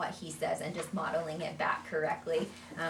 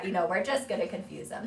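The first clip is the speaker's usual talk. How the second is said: usual speaking voice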